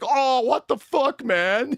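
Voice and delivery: high, loud, slow mock disbelief; stunned kid. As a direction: play the robbed kid's shock. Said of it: "The kid's like".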